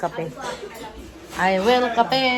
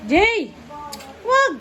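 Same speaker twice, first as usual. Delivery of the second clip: real shouted reprimand; calling out; 6 semitones higher than usual. Real, not acted. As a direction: shout it short and high to stop someone. Calling out sharply to stop Jay.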